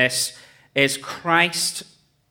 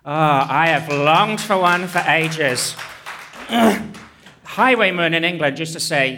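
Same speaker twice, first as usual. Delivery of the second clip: usual speaking voice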